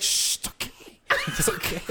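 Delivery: hushed, soothing whisper. Retold or imitated. Casey whispering to his friend in bed, hushed. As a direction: breathe it like calming a child.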